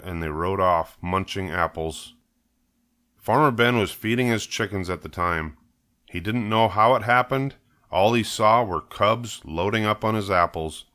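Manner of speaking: ordinary delivery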